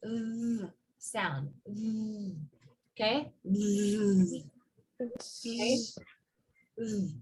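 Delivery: soft held Z buzz; quiet drawn-out Z demo; buzzing Z sound. Shown as a demonstration. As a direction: vibrate the vocal cords on a Z. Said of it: "to make that z sound".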